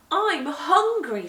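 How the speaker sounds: eager hungry plea. Acted. Jenny pleading eagerly at the steaming porridge pot. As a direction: stretch the words, eager for food.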